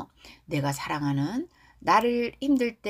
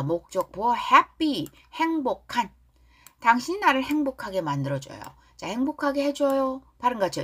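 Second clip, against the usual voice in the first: ordinary talk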